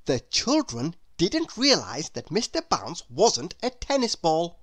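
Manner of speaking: plain reading voice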